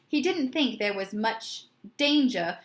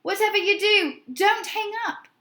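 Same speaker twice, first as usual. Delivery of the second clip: high, urgent shout; urgent yell; 9 semitones higher, 4 dB louder than usual. A narrator's reading. Mister Music shouting to Tony on the phone, urgent. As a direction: beg him loudly not to hang up. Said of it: "he yelled".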